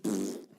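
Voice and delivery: soft, low lip-puff burst noise; imitated burst. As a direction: puff out a little explosion sound. Imitates veins bursting from the whipping.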